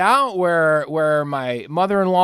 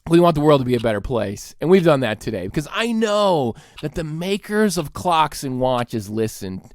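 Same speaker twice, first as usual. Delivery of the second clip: normal talking voice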